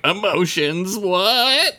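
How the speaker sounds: high, bewildered squeak on what; confused Vulcan. Retold or imitated. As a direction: pitch shoots up in baffled disbelief. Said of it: "'cause they're like".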